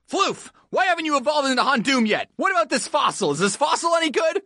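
high-pitched, agitated grilling; frantic exasperation. Acted. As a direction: fire off questions in a rising panic. Acted frantic grilling of team.

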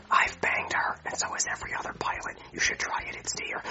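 soft whispered confiding aside. Acted. Pilot whispers gossip to Marvin about the attendant, hushed whisper. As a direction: lean in and whisper it so she can't hear.

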